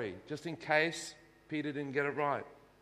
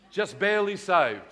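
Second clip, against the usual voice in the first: wry emphasis; 2 semitones higher, 7 dB louder than usual. Acted. Joke about barely saved members.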